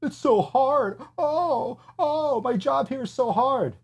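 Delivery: high, slow whiny imitation of a complaining partner; mocking falsetto whine about an easy job. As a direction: mimic their whining in a high voice. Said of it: "All you do is sit there".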